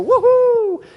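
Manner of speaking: a high, loud swing-set woohoo; gleeful whoop. Retold or imitated. Preacher recalling kids on swings, whooping with glee. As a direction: whoop like a kid flying forward on a swing. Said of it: "you go".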